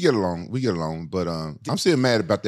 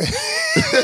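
a big high laugh bursting out; a sudden loud laugh at his own story; loud burst of laughter; 3 dB louder than usual. Real, not acted. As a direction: crack up loudly at yourself.

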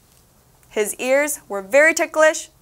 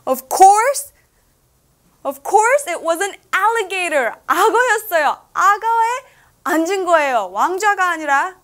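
excited reveal; 6 semitones higher, much faster than usual. A narrator's reading. Telling the child the throne was an alligator, excited. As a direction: burst out with the surprise.

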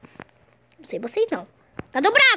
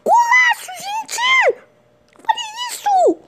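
goal celebration shout; 13 semitones higher, slower than usual. Real, not acted. Gamer celebrating a stunning goal for his viewers, ecstatic shout. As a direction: scream it as the ball hits the net.

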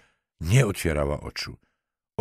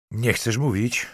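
raised, pointed questioning with a threat in it; sharp question; 6 semitones higher than usual. A narrator's reading. Lieutenant threatens the girl who won't talk, sharp. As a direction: ask it sharply, pitch lifted. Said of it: "zapytał ostro".

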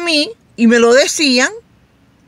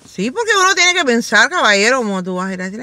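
usual speaking voice

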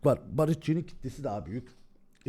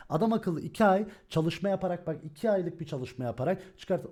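ordinary talk

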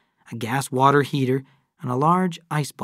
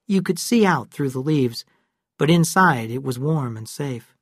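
ordinary delivery

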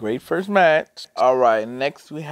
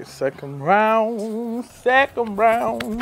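slow, high fight announcer; 9 semitones higher, 4 dB louder, much slower than usual. Pretend. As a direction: call the round like an announcer. Announcing round like game announcer.

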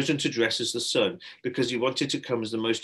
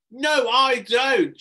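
high, loud, slow refusal; loud high boy's protest; shouted; 13 semitones higher, 9 dB louder, much slower than usual. A narrator's reading. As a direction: shout it out of fear. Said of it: "shouted Har".

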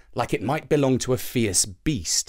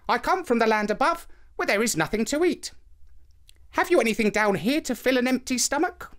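put-on higher, polite young boy; boy's voice; 11 semitones higher than usual. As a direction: greet her as a polite boy. Hungry boy asks old woman.

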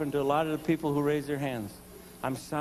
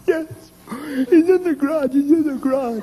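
high, loud, panicky insistence; frantic wife; 11 semitones higher, 7 dB louder than usual. Retold or imitated. Wife insisting to husband, high, loud and frantic.

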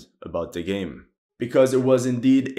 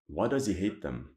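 much softer, lower, to himself; puzzling it over quietly; muttered repeat; 3 semitones lower, 10 dB quieter than usual. Real, not acted. Historian repeating his question, quiet musing.

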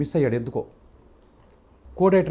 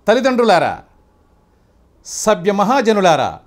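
NTR Duryodhana dialogue. Shown as a demonstration. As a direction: proclaim it as the proud emperor before the court. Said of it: "మీకు రామారావు గారిది దానవీర స్వర్గంలో dialogue చెప్తాను".

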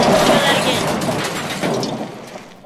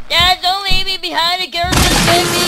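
put-on higher, childlike plea to dad; pleading kid voice; 6 semitones higher than usual. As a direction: play the scared son begging not to be left. Voicing the son left behind.

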